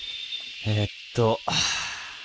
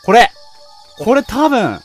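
tense loud urgent; 5 semitones higher, 11 dB louder than usual. Real, not acted. Tense while chased.

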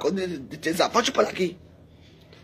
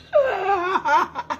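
high-pitched, wailing laugh; high cackle; 11 semitones higher than usual. Real, not acted. Streamer asking where rival stands today, high cackle.

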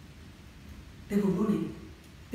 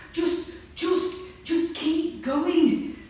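urgent; 9 semitones higher, 6 dB louder, much slower than usual. Acted. Jo urging Georgie on.

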